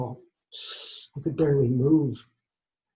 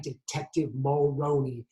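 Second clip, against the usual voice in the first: plain reading voice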